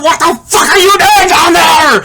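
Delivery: high, loud angry shout; acted scream over the machines; screaming pressman. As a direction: yell it like a furious foreman. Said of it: "the pressmen are screaming at me".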